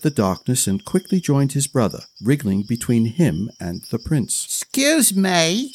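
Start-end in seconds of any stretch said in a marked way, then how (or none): 4.5-5.7 put-on high, slow haughty little boy; arrogant. A narrator's reading. Little one to Brian, high slow arrogant child. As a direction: demand it imperiously. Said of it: "arrogant look".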